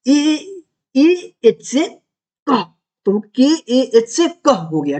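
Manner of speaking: ए एच से drawn out slowly, letter by letter; slow spelling. Shown as a demonstration. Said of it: "दुर्बाछी क क्या होता है". (धीरे-धीरे एक-एक अक्षर बोलते हुए)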